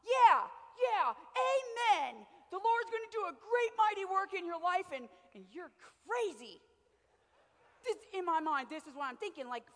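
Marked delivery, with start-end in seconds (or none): 0.0-6.6 much higher and louder, gushing amens; excited praise. Retold or imitated. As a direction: hype him up. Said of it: "And I'm like".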